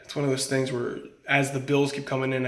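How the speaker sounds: ordinary talk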